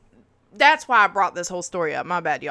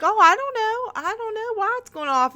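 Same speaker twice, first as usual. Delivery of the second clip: mother feigning innocence in a much higher voice; mom playing innocent; 14 semitones higher than usual. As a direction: squeak out a mock-innocent denial, much higher. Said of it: "she's acting like".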